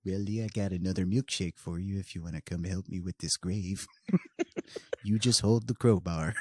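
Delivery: creepy dude down the street voice, low and quiet; lightly voiced creepy neighbor offering milkshakes; Ed Gein impersonation. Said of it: "my Ed Gein impersonation sounds really fucking weird".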